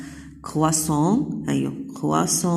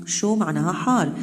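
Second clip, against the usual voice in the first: ordinary talk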